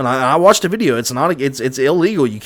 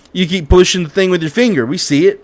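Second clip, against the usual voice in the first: higher, louder accusation; accusing; 6 semitones higher, 4 dB louder than usual. Retold or imitated. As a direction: call him out, raised voice. Accusing in retold argument.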